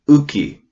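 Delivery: Uki, catch in the throat then vowel; Kootenai word. Shown as a demonstration. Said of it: "that glottal mark".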